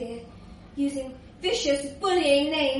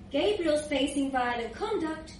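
ordinary delivery, nothing marked

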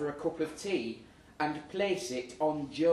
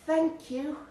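imitating her thanks; 12 semitones higher than usual. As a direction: lightly voice a polite woman. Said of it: "she said".

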